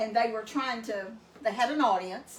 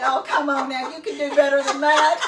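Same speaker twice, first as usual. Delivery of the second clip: laughing tease; 3 semitones higher, 10 dB louder than usual. Retold or imitated. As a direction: tease him while cracking up. Teasing retort to the man.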